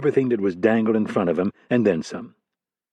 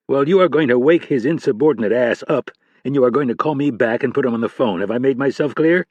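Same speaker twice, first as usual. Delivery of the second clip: a senior director grilling a junior agent; stern FBI boss; 2 semitones higher than usual. Acted. Boss giving orders.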